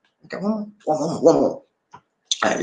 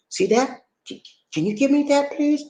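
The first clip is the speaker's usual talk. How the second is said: higher, considerate ask; gentle polite request; 10 semitones higher than usual. Shown as a demonstration. Teacher showing how to ask a shop clerk, gentle and polite. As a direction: ask politely, pitched up, so nobody feels embarrassed. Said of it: "talk to 'em in a way they can be receptive".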